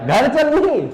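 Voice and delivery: घर चल नहीं said high and pointed; wrong order rejected. Shown as a demonstration. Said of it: "चल घर". (ऊँची आवाज़ में गलत क्रम को नकारना)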